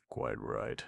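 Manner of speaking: soft, low, amused murmur. A narrator's reading. Vance calmly answering angry Markham, amused murmur. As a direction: murmur it with a smile. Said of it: "murmured Vance, still smiling".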